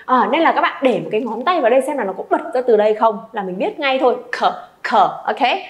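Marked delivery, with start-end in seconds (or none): 4.3-5.2 "Khở" low and short, from the throat; throaty kh. Shown as a demonstration. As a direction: push "khở" out from deep in the throat. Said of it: "phát ra từ cuống họng của mình". (bật "khở" ngắn từ cuống họng)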